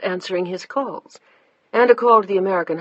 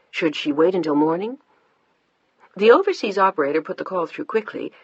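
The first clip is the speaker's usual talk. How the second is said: plain reading voice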